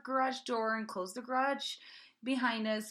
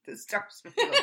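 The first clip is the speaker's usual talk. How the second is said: laughing; 8 dB louder than usual. Real, not acted.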